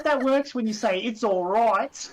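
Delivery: slow, high, loud mock line; playful quoted retort; mock quote. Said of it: "I'm like".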